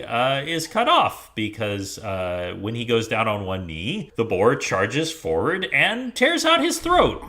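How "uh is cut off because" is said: ordinary talk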